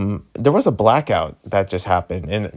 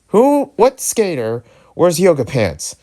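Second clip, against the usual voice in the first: higher, slow and incredulous; incredulous question; 9 semitones higher, 4 dB louder, slower than usual. Real, not acted. Vlogger mocking the skater who wears yoga pants, incredulous. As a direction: drag out the disbelief, voice jumping up.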